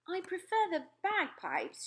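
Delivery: wry rebuke; the Queen. Acted. Queen recovering.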